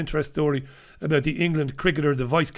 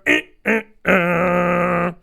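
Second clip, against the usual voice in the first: imitated meter alarm; 3 semitones higher, 4 dB louder, much slower than usual. Describing a newly found smart meter, mimicking its beeps. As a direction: mimic a beeping machine.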